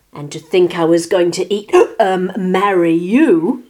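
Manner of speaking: loud outburst with a gasping slip; indignant lizard. A narrator's reading. Lizard nearly admits he'd eat Martina, loud and indignant. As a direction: break off, then stretch the correction loudly.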